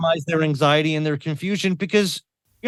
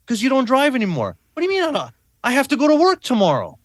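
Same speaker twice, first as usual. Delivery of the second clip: much higher, urgent and pleading; panicked protest; 10 semitones higher, faster than usual. Retold or imitated. Voice climbing with confused panic. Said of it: "he spiraled for, like, an hour".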